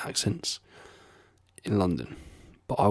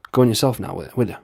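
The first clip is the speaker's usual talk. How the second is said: Irish accent, louder and sing-song; sing-songy Irish lilt; 5 dB louder, faster than usual. Shown as a demonstration. Imitating his Irish-descended relatives, in a sing-songy Irish accent. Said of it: "sing-songy kind of thing".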